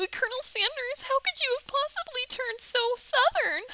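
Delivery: high, squeaky laughing voice; helpless giggling. Real, not acted. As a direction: squeeze it out through real laughter. Laughing at own Southern voice.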